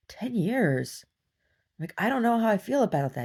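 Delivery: retold surprise. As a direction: react as if the offer just landed.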